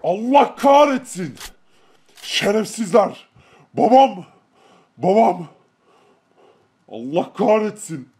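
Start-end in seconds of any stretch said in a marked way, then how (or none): 3.7-5.5 louder anxious calls; alarmed calling. Acted. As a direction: call out to the wounded father. Finding his father hurt. (endişeyle seslenme)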